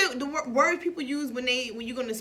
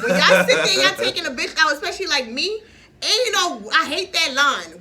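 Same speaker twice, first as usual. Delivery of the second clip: louder, higher, laughing rant at men; heated rant; 4 semitones higher, 6 dB louder than usual. Real, not acted. Woman lectures men about date lines, loud and laughing. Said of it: "let me tell y'all something right now".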